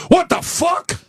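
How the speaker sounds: put-on high, startled kid voice; child's outburst. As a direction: squeal it like a shocked five-year-old. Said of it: "he sees a bug and goes".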